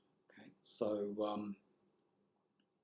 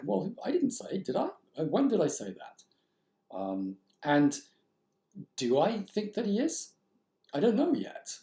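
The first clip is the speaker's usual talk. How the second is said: mock-puzzled reader; 8 semitones higher than usual. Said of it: "questioning me like this".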